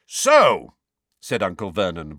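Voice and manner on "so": loud, higher, booming start; full volume. A narrator's reading. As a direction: boom it out to take charge. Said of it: "voice restored to full and considerable volume".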